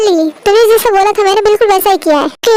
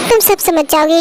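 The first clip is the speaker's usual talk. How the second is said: usual speaking voice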